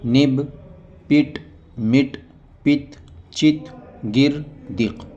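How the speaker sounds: each word said on its own, with pauses; reading drill. Shown as a demonstration. Teacher reads Qaida words to students, slow drill. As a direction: read the word list slowly for learners.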